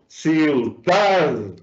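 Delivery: Ciudad drawn out, punched on DAD; stress on final DAD. Shown as a demonstration. Teacher answering where the accent is, stress on DAD in Ciudad. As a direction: hit the last syllable of Ciudad, then let pitch fall. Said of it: "Al final".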